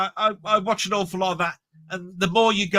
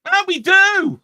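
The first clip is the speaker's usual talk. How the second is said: high, loud mock protest; playful shout; 11 semitones higher, 6 dB louder, slower than usual. Bantering with co-host about Southern shows, loud playful protest. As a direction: fire it back loudly in jest.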